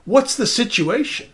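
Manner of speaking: playing a startled neighbor who knocks to ask what's wrong; alarmed neighbor asking. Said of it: "if I were to go knock on the door".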